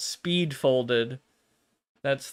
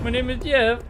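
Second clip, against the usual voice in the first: higher, deadpan movie quote; Channing Tatum's line; 9 semitones higher, 4 dB louder than usual. Retold or imitated. Imitating Channing Tatum introducing himself in a higher, deadpan voice. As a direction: blurt it out with a straight face, a bit higher. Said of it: "Channing Tatum comes over and is like".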